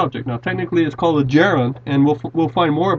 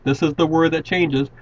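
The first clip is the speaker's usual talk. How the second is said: ordinary talk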